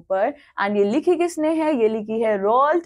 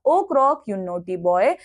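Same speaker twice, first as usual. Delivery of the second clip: lower, slow, teasing lady voice; fond scolding lady; 4 semitones lower, much slower than usual. A narrator's reading. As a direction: chide the pet croc fondly and slowly. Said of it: "उसने अपने crocodile को देखकर बोला". (प्यार से डांटती महिला)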